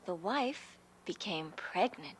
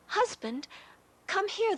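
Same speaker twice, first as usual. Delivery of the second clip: much higher, louder call; wife calling; 11 semitones higher, 4 dB louder than usual. Acted. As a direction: call husband across the house. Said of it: "the wife said".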